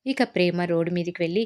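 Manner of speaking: plain reading voice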